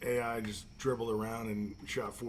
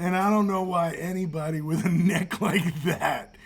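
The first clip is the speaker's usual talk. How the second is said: laughing through words; 4 semitones higher, 8 dB louder than usual. Real, not acted. Laughing while retelling a joke.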